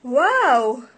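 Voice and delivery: loud, bright exclamation of delight; excited wow. Acted. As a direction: burst out with enthusiasm. Reacting to Bali idea.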